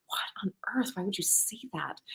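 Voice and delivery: whispered, stunned disbelief; shocked whisper. Retold or imitated. Reacting to a sexist comment, shocked whisper. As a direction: breathe it out in a hushed whisper.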